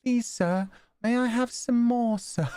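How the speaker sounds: put-on high, small British child voice; high-pitched mock pleading child; pleading British orphan imitation. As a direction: beg politely like a little British waif. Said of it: "She sounds like she's asking".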